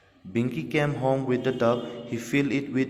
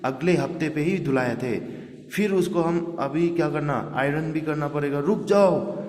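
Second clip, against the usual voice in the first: shouting; 5 semitones higher than usual. Retold or imitated. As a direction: yell to stop the tub. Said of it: "वास्तव को चिल्लाने लगा". (ऊँची आवाज़ में चिल्लाना)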